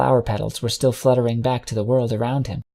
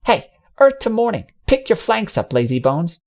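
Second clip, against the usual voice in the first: high, sharp call into his ear; much higher, teasing bark to wake the sleeper; 9 semitones higher than usual. Acted. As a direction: jolt a dozing friend awake. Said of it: "spoke sharply into the stallion's ear".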